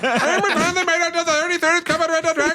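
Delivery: put-on mild old-timey radio voice; hint of a vintage broadcaster; 1930s announcer voice. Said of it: "No, it goes like this".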